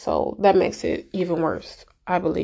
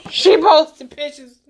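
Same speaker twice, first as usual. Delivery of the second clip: high, loud, drawn out through laughter; slow, laughing squeal of disbelief; 7 semitones higher, 3 dB louder, slower than usual. Real, not acted. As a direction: crack up while saying it. Cracks up over grandma story.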